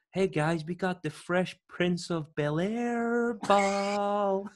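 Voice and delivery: high, slow, drawn-out mocking call to the crowd; milk the joke for the whole arena; mocking announcer shout. The American showman mocking him to the crowd, high and slow. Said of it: "he started shouting".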